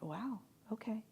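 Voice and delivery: a hushed, taken-aback wow; whispered shock at a confession; stunned whisper. Retold or imitated. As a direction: breathe it out in a soft whisper. Stunned reaction to his confession.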